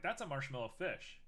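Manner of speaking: usual speaking voice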